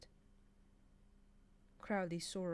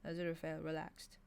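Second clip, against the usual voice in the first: usual speaking voice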